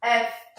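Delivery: "F" dito "éf", numa sílaba só; pronúncia em inglês. Shown as a demonstration. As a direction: corte o "i" final de "F". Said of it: "na língua inglesa será". ("F" como "ef", à inglesa)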